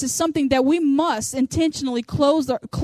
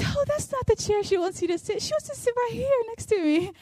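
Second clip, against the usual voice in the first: high, softer sing-song chirp; happy lady; 9 semitones higher, 5 dB quieter than usual. Retold or imitated. Happy lady inviting a stranger to sit beside her, chirpy imitation. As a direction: imitate a sweetly over-friendly woman. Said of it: "and the happy lady goes".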